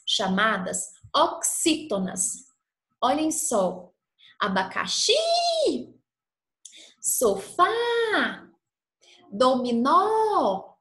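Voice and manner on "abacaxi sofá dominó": "abacaxi, sofá, dominó" with the final XI, FÁ, NÓ held long; stress on last syllable. Shown as a demonstration. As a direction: lean hard on the end of "abacaxi", "sofá", "dominó". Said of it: "Todas essas palavras têm a última sílaba". ("abacaxi, sofá, dominó" com última sílaba forte e alongada)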